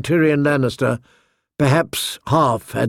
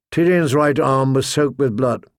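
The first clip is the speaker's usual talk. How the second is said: ordinary delivery, nothing marked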